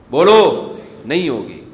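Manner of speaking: slow, loud forbidding; stern command. Acted. Preacher forbids raising voices before elders, slow and loud. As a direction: lay it down firmly to the congregation.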